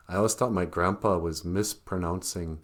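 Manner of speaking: ordinary talk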